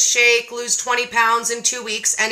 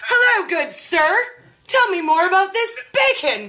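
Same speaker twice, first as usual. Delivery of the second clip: higher, hammed-up seductive greeting; flirty swoon; 7 semitones higher than usual. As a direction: pretend to woo the video guy, pitch raised.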